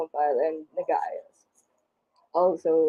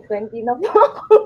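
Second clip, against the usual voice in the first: laughing; 4 semitones higher, 5 dB louder than usual. Real, not acted. Amused by question about age.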